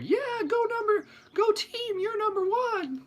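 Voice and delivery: very high squeaky cartoon cheer; squeaky cartoon ancestors. Ancestors cheering from the crowd in a squeaky cartoon voice. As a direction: cheer like a tiny cartoon fan. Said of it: "they sound like those cartoon characters".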